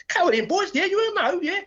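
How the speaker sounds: high, hammed-up tough challenge; mock fearless mum goading the boys; mum taking on skinheads. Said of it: "She was a nutter".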